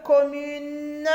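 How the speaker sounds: "commune" with its ending held long; slow down and stretch "commune" for the class; drawn-out word. Shown as a demonstration. Teacher reading exercise to children.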